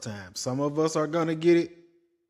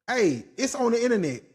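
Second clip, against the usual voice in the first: normal talking voice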